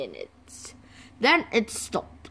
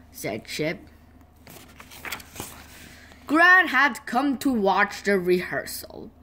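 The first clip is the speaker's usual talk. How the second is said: plain reading voice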